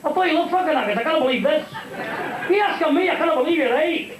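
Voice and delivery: high-pitched baffled wife; comic high voice, protesting in disbelief; Scottish wife. Acted. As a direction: play the bewildered widow up high. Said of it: "Said the Scottish fellow's wife".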